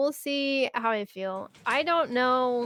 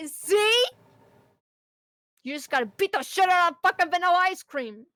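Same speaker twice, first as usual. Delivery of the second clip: much higher and louder, exclaiming; triumphant shout; 7 semitones higher, 5 dB louder than usual. Retold or imitated. Worker proving the machine works, triumphant shout. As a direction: crow it after smacking the machine. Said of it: "I was like".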